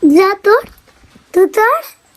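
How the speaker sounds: calling out. Real, not acted. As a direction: shout the dare at the dog. Dares the dog from afar.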